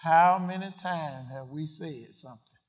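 ordinary talk

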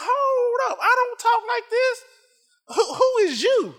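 very high-pitched, indignant challenge; indignant retold rebuke. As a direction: push it way up high, like confronting an intruder. Acts out rebuking the enemy's voice.

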